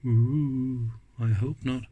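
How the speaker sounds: usual speaking voice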